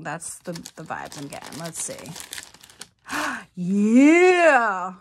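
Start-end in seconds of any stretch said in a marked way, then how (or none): 3.1-4.9 gasp, then a loud triumphant yeah; delighted gasp. Real, not acted. Unwrapping a candle, guessed its color right, a gasped cheer.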